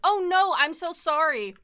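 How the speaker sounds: high, loud, over-the-top apology; mock apologetic. The apology she refused to give, high and mock-sorry. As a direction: grovel up high like a people pleaser.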